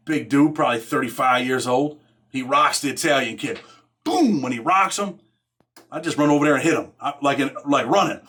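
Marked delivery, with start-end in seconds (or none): 4.1-4.4 much higher, sharp "Boom!" for the hit; punch sound effect. Retold or imitated. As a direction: slam out the impact. Punch landing in fight story.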